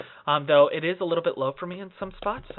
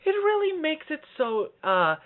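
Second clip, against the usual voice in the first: high, slow, loosened head voice; retry it high without tightening; relaxed head voice; 13 semitones higher, slower than usual. Shown as a demonstration. Coach redoing the high demo with an open throat, slow high voice. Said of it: "I just tightened my throat".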